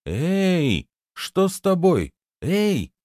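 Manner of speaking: high-pitched startled calling; surprised. A narrator's reading. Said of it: "удивлённо проговорила Лунта".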